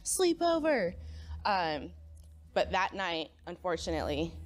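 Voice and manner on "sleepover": high, drawn-out whispery cheer; imitated giddy squeal; excited. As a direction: re-enact a thrilled kid, half-whispered squeal. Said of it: "I was so excited".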